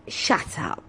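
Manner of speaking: breathy mock whisper of shut up; retold shut up. To a friend teasing about the butchers, a mock whisper. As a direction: hiss it under your breath, half joking.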